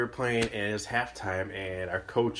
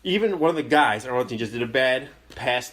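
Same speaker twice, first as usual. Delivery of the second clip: ordinary delivery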